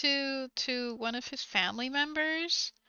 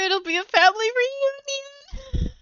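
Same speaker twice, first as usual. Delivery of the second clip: much higher, louder playful quip; cheeky boyfriend; 11 semitones higher, 5 dB louder than usual. Retold or imitated. Boyfriend jokes about dinner invitation, cheeky. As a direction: toss it off as a joke. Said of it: "he was like".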